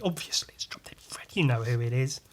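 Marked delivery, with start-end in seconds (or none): 0.0-1.3 soft whispered conspiratorial aside; hushed whisper. Real, not acted. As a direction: drop to a whisper as if sharing a secret.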